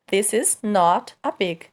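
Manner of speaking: each word said apart, slowly; slow careful. Shown as a demonstration. Teacher showing students the careful slow version. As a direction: spell it out slowly for learners. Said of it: "E se a gente fala rápido?".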